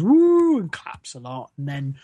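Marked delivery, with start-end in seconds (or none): 0.0-0.6 high, loud whoop; audience whoop. Retold or imitated. Mimicking the audience's reaction, high loud whoop. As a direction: imitate a cheering crowd going woo. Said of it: "the audience kinda goes".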